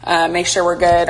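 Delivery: usual speaking voice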